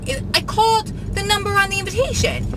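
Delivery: imitated little aunt, very high voice; high-pitched aunt. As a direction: pitch it right up as the adorable aunt. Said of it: "this high-pitched, adorable voice".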